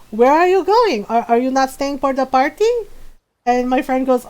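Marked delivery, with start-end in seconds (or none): 0.1-2.7 higher, louder mom questioning a departing guest; imitated mom, taken aback. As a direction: call out like a mom stopping a guest. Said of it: "my mom turns around and goes".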